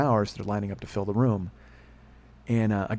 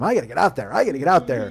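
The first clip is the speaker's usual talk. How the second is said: louder, insistent rallying refrain; emphatic self-urging; 3 semitones higher, 6 dB louder than usual. Retold or imitated. Podcaster urging himself out into the world, emphatic. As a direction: urge yourself on, punching it louder. Quotes own repeated pep talk.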